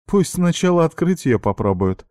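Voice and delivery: high mocking call. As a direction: taunt them lightly. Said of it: "раздался насмешливый голос Марьяны".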